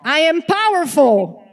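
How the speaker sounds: shouted affirmation, all in; like your life depends on it; full-out shout. Shown as a demonstration. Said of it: "like your life depends on it".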